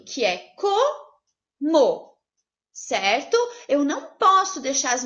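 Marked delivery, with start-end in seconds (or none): 0.0-2.2 como said very slowly, split into co and mo; syllable-split como. Shown as a demonstration. Teacher showing the single split of como, slowly. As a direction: drag out como with a clear pause in the middle. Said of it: "só uma opção".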